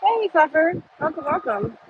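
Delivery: high, sweet hello to a pet; pet greeting. Real, not acted. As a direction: coo a welcome to the animal.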